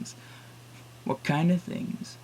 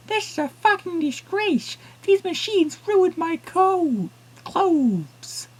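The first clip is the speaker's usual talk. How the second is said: angry woman; 6 dB louder, much slower than usual. Acted.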